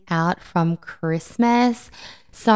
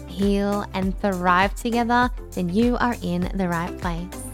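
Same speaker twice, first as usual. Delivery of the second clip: polished upbeat show intro; podcast intro voice. Acted. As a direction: read it like a podcast opener. Podcast intro over music.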